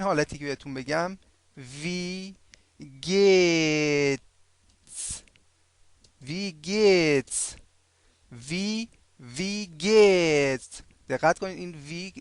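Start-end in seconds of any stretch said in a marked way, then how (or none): 1.6-10.8 "وی گیتس" stretched into long syllables; very slow model. Shown as a demonstration. Teacher introduces German how-are-you, "وی گیتس" very slow and drawn out. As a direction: draw out both vowels of "وی گیتس" for learners.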